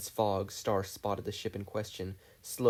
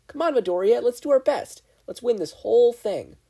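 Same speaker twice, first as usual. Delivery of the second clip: high, loud rallying cry; fired up; 12 semitones higher, 9 dB louder than usual. Acted. Ochako rallying Midoriya to win, fired up. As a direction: pump him up to win.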